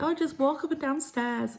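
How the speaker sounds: high, light mock suggestion; imitating advice-givers. People suggesting stairs as exercise, mocking imitation. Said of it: "I know people are like".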